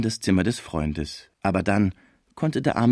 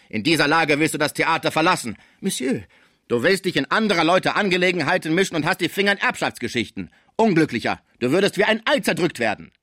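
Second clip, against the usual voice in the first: higher, insistent scolding; domineering theatre director; 8 semitones higher than usual. A narrator's reading. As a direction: press on over the underling's objection. (herrisch, mit erhobener Stimme)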